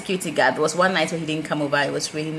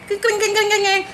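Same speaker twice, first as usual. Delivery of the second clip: high, louder ringing bell sound; bell imitation; 13 semitones higher, 4 dB louder than usual. Describing a faint warning bell, high ringing chime. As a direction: chime it like a little alarm.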